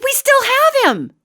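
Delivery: very high, slow eager voice; eager shopkeeper. Retold or imitated. Pet store owner proudly offering the old puppy, in a high eager voice. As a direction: sing it out like you've saved him. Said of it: "She's like".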